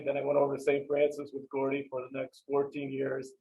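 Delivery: normal talking voice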